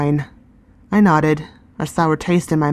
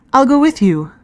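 higher, bursting-out offer; a girl blurting it out, pitched up; eager girl; 9 semitones higher, 4 dB louder than usual. Acted. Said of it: "she said".

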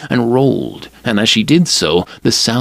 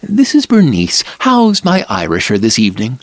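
a lively mother calling, pitched up; Mrs Braun's voice; 2 semitones higher than usual. Acted. Mrs Braun calls on phone.

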